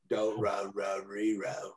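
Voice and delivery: hammed-up film-parody English accent; imitated comedy-skit English accent; mock English accent. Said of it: "make fun of a Guy Ritchie film".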